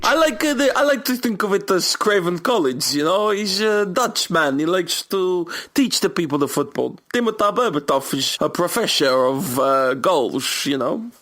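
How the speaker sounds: hammed-up high Dutch accent. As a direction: play a Dutch coach with a thick accent. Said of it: "dodgy Dutch accent".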